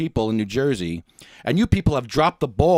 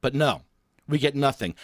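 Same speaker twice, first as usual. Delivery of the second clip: normal talking voice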